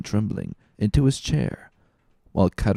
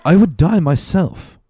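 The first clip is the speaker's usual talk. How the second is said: higher and louder, a fierce vow; unshaken resolution; 9 semitones higher, 8 dB louder than usual. A narrator's reading. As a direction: swear it as a final, unshakable promise. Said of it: "with the accents of unshaken resolution".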